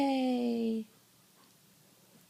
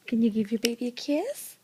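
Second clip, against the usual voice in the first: ordinary talk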